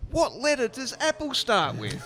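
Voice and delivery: high, slow mock-exasperated question; exasperated retort. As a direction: spell it out slowly, way up high, as if to an idiot. Retold exasperated phone quarrel.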